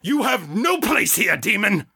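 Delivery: high commanding preacher voice; preacher rebuke. A narrator's reading. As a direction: hurl it at the demon. John rebuking demon again.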